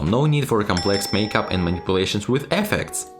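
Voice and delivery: ordinary delivery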